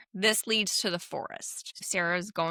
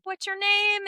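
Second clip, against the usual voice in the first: put-on high girly Sarah voice; 12 semitones higher than usual. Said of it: "And she's like".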